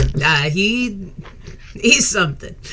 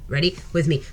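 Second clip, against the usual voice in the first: ordinary delivery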